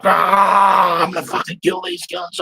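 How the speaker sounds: high, wild shout; hammed-up replay of a raving, out-of-control friend; mate on a bad trip, screaming he'll kill everyone; crazed shout. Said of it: "He was like".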